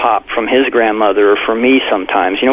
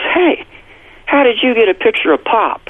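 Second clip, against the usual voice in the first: put-on high, bright little-boy voice, much higher; imitating his small son's eager question; excited child; 10 semitones higher than usual. Father retelling son asking about Pop, high excited child voice.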